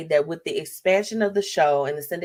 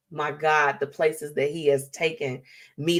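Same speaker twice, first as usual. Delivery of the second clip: ordinary talk, nothing marked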